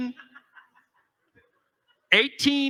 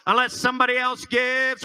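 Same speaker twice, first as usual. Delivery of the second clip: normal talking voice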